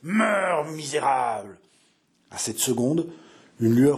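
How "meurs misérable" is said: furious cry. Acted. François finishing off his wounded brother, raging cry. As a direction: hurl it down at him in hate. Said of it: "gronda-t-il".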